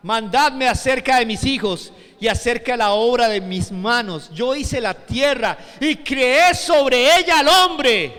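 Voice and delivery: loud declamation building to a high shout; thundering preaching. Acted. Preacher voicing God as creator, thundering proclamation. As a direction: build to a slow, stretched shout.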